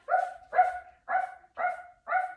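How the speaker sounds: high, loud playful barking; dog barks. Shown as a demonstration. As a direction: bark like a puppy chasing the cat. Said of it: "Can you be a chasing dog?".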